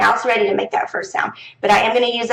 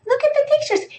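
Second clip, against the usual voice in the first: bright, much higher cue to the class; high teacher prompt. Shown as a demonstration. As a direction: point the kids at the pictures, up high. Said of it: "And I'm like".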